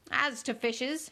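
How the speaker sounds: slow, grave delivery; slow and solemn. Acted. Queen confides in Alice, slow and solemn. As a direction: draw it out solemnly. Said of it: "very slowly and solemnly".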